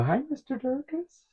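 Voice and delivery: put-on high, soft, sugary kid's greeting; high little-boy voice, soft and sweet. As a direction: imitate a sweet young boy saying hi. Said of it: "he talked very sweet".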